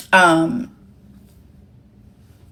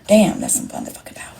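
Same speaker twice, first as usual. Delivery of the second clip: whispered, fast and smug; gloating whisper; 4 dB quieter, faster than usual. Real, not acted. Streamer to chat, savoring her power in a whisper. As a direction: hush it like a secret boast.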